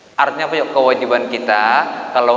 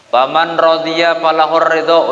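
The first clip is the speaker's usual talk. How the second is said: a louder, higher chanted Arabic recitation; hadith recitation; 4 semitones higher, 4 dB louder than usual. Acted. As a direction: intone the hadith like scripture. Arabic hadith quoted in sermon.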